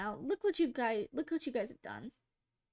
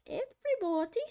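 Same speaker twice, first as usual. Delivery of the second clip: posh British woman; 8 semitones higher, 4 dB louder than usual. Retold or imitated. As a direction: stretch it out like a prim British lady. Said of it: "Somebody's like".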